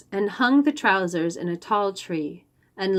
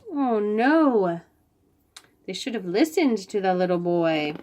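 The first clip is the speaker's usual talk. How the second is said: ordinary delivery, nothing marked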